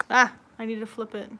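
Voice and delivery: ordinary talk, nothing marked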